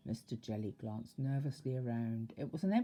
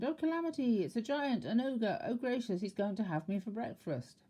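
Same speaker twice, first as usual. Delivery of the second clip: terrified panic; 7 semitones higher than usual. A narrator's reading. Mister Jelly thinking the tramp an ogre, high panicky wail. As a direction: squeal in terror.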